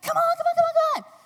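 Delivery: excited calling. Retold or imitated. Calling husband into the water.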